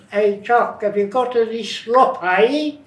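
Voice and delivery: high, loud Cockney call; loud high Cockney voice calling up to the house; Cockney imitation. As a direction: shout it up like an old Cockney. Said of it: "I used to learn how to imitate".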